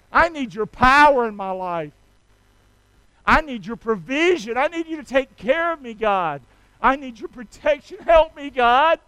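loud, earnest pleading; praying aloud for protection; fervent prayer. Acted. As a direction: ask God for help with force. Voiced prayer.

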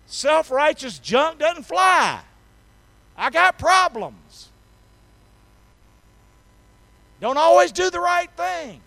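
loud, high-pitched confession; fervent preaching. Real, not acted. Preacher confesses his faults to the congregation, fervently loud. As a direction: proclaim each line high and hard, with pauses between.

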